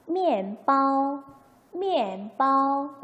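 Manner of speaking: slow 미엔바오, 바오 held high, level and loud; pronunciation demo. Shown as a demonstration. Teacher models the word for bread, slow tone demo. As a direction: stretch 미엔바오, falling on 미엔, high flat 바오. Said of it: "미엔 하고 읽겠죠?".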